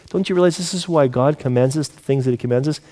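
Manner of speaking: normal talking voice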